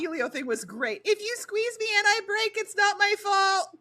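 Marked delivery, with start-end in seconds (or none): 1.0-3.7 higher, cheeky quoted character line; mock character voice. Friend retells a game character's line, higher and cheeky.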